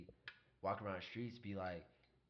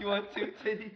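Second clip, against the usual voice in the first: high, loud mock server offering seconds; laughing lunch-lady impression; cafeteria lunch lady; 12 semitones higher, 8 dB louder than usual. Lunch lady offering servings, high laughing mock voice. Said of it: "like she was a cafeteria lunch lady".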